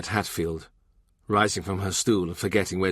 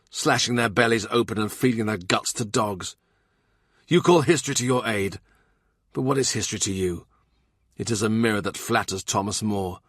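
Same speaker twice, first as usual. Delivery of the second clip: a raised, cutting rebuke; Cromwell, furious. Acted. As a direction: tear into him with rising anger.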